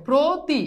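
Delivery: প্রতি broken into "pro, ti" with a round o; teacher's demonstration of প্রতি with o in the first syllable; syllable-by-syllable demo. Shown as a demonstration. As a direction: sound out প্রতি as two held syllables. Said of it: "ওকার হবে, তাহলে কি হবে?".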